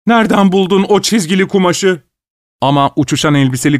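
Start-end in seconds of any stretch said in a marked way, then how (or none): 0.1-2.0 high-pitched shouted question; calling out shouting. A narrator's reading. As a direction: call the question after someone, pitched high. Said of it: "diye bağırıyordu".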